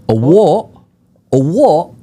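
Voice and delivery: put-on high, loud English-accented "what?"; indignant drunk English fan, mock-imitated. Drunk English fan challenging an interviewer, incredulous English accent. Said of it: "in his English accent".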